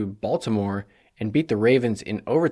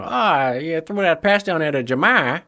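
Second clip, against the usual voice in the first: higher, louder imitation of a Boston reporter; light Boston accent on a higher, louder voice; 5 semitones higher, 5 dB louder than usual. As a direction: play a thick-accented Boston reporter asking a question. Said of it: "super thick Boston accent".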